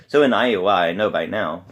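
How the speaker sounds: "Iowa" and "now" punched loud in a march beat; loud, percussive march-rhythm demonstration. Shown as a demonstration. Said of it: "you go from that march rhythm".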